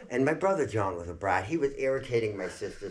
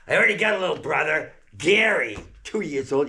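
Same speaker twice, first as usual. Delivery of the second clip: put-on higher and louder, a fast worked-up boy; loud kid voice; 6 semitones higher, 8 dB louder than usual. Boy answering mother, loud fast kid voice. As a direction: blurt it like a cheeky kid.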